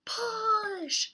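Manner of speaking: high, loud Push; effortful shout. Acted. Friends pushing bikes up the hill, loud and high. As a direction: strain it out up the hill.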